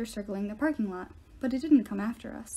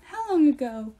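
faltering voice; 4 semitones higher, 7 dB louder than usual. A narrator's reading. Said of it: "Her voice faltered".

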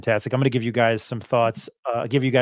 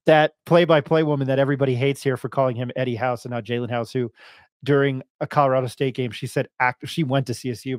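ordinary talk

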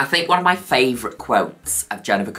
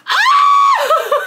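laughing scream; 7 dB louder than usual. Real, not acted. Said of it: "laugh shout".

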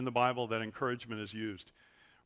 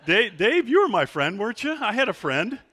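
laughing plea; 7 semitones higher, 8 dB louder than usual. Real, not acted. Pastor laughing, appealing to friend.

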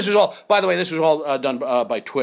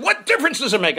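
exasperated; 11 semitones higher, 3 dB louder than usual. Retold or imitated. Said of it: "And I said".

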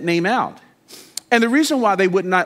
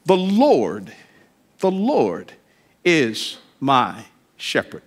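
slow savoring recitation; 4 semitones lower, slower than usual. Shown as a demonstration. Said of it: "let's just sit there for a minute".

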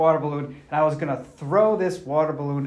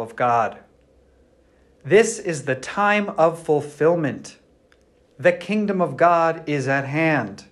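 gospel reading; 5 dB louder than usual. Acted. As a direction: read it out like a priest at Mass.

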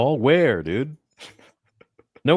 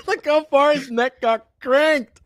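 high, loud laughing words; laughing shout; 4 dB louder, slower than usual. Real, not acted. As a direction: laugh through the words in disbelief. Laughing at slap replay.

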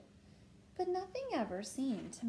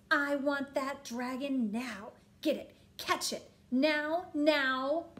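loud, insistent, building princess orders; yelled demand; 4 semitones higher, 8 dB louder, slower than usual. A narrator's reading. Princess demanding servants catch the dragon, yelling. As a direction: build louder with each command. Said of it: "yelled the little princess".

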